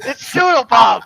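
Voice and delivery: loud, slow, drawn-out announcement; shouting the reveal of Doodle Bob; excited shout. Acted. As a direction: yell it out like a big reveal. Announcing a surprise character.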